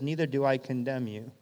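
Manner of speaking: low, soft reassurance; gentle pardon. Acted. Jesus pardons the accused woman, soft and gentle. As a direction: drop the voice and forgive tenderly.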